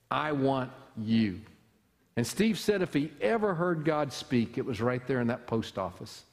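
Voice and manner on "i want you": slow, deliberate recruiting-poster line; lightly played Uncle Sam pointing at you. Pastor describes the old recruiting poster, slow and pointed. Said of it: "Uncle Sam on it, pointing and saying".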